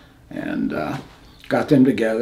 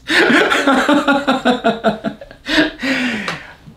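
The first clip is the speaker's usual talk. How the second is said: long laugh. Real, not acted. Laughs after near-death story.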